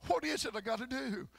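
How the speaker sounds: normal talking voice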